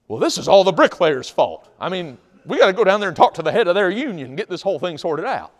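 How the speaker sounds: loud, high acted complaint; mad at coworkers. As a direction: play an indignant worker laying blame. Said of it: "They're mad at each other".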